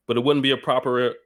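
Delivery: ordinary talk, nothing marked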